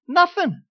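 Nothing flung out high and drawn down; emphatic nothing. Retold or imitated. Preacher to church, wife's silence, emphatic high Nothing. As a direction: stamp the word Nothing high then let it fall.

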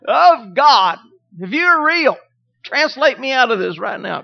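loud, high desperate plea; frightened shouted prayer; panicked prayer. Retold or imitated. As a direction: beg God like the coaster is about to drop. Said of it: "I wasn't even saved and I was praying".

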